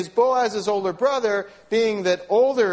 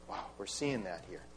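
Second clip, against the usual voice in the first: usual speaking voice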